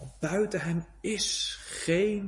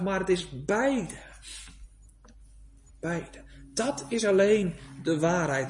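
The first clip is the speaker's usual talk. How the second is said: ordinary talk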